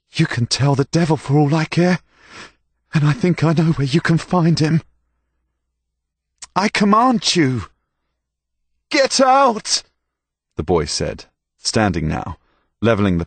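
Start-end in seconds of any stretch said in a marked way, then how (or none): 0.1-4.8 loud, scornful refusal; a scared lad lashing back; defiant anger. A narrator's reading. Stable boy defies master. 6.5-7.7 high, loud final order; forceful command. A narrator's reading. Master repeats his order. 8.9-9.8 high, loud, desperate shout; shouted threat. A narrator's reading. Stable boy threatens with pitchfork.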